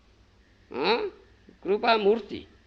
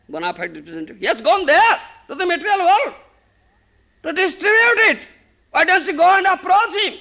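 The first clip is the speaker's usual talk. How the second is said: high, loud, scolding god; Krishna shouting down at a foolish beggar; 8 semitones higher, 7 dB louder than usual. Acted. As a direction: berate the rascal from on high. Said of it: "Oh Krishna, oh Gopinath".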